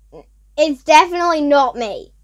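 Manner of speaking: usual speaking voice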